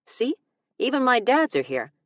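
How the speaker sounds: delighted as proof; giddy triumph; excited. Acted.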